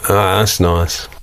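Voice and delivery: low, slow appreciative murmur of a sniffer; mellow mock approval. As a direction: act out an easygoing, low-pitched approval. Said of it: "Just like that".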